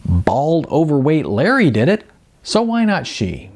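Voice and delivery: ordinary delivery, nothing marked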